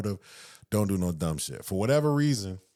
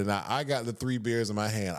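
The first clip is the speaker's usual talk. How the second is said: ordinary delivery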